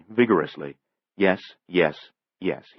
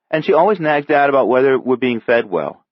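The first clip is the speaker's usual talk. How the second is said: put-on higher, louder griping kid; exasperated child; 7 semitones higher, 8 dB louder than usual. A narrator's reading. Boy gripes to his sister about nagging aunt, loud exasperated complaint. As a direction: complain with indignation.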